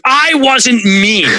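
high, slow, indignant denial; defensive pushback. Retold or imitated. Acting out arguing back at a loved one, defensive and slow. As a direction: protest it like you've been wrongly accused. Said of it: "I can fight back and be like".

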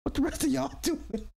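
high, hyped-up shout-out; excited hype. Real, not acted. Shouting out Macbone to the chat, excited and high. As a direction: hype him up, voice way up.

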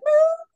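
high loud mock sobbing wail; mock sob wail. Fan sobbing at the reunion scene, high mock wail. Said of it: "sobbing my face off".

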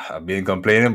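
ordinary delivery

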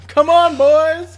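high, slow, loud complaint; loud drawn-out protest. Acted. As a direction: stretch it out in protest.

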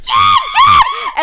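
high-pitched mock scream for someone; imitated screaming. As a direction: screech the name out, imitating a panicked call. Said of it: "what's her face is screaming for".

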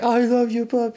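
high, cooing voice for a puppy; doting baby talk. Retold or imitated. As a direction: gush over the dog like a baby. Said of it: "he was like".

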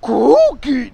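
high, loud monster shout; Cookie Monster voice. Shown as a demonstration. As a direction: yell it like a furry monster. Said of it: "practicing my Cookie Monster impression".

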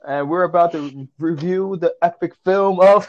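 big hyped host voice; loud announcer. Acted. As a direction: belt the welcome like a show host. Continues the show intro.

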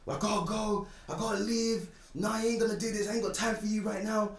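high, agitated impression of her protests; imitated screaming mum. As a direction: mimic a worked-up mother in a high voice. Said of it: "She starts screaming".

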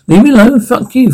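high-pitched exasperated plea; upset outburst. Acted. Character angrily begs to be left alone.

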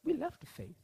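lightly voiced, high confession; voicing the people. Believers admitting they left the faith, mildly high. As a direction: pitch it up, a touch pleading. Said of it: "they're saying".

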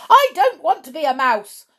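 high, loud tantrum shout. A narrator's reading. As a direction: yell it, stamping about. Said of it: "Bruno shouted".

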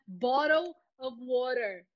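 "bottle of water" with American tapped Ts; American accent. Shown as a demonstration. Teacher showing students flexible listening, American pronunciation. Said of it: "gostando mais do americano".

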